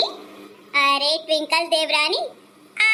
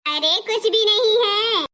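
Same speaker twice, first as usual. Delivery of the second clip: normal talking voice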